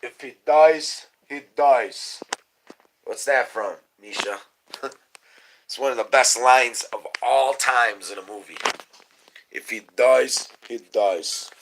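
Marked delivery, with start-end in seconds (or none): 0.0-2.2 loud, slow movie-villain line in a Russian voice; loud, drawn-out Rocky Russian impression. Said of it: "Remember the Russian?". 9.5-11.5 lightly voiced Russian boxer quote; a hint of the movie Russian; Russian from Rocky. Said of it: "the Russian from Rocky".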